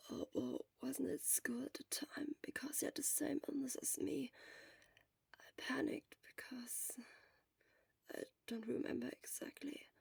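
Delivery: weak sick whisper; faltering, barely voiced; hoarse whisper.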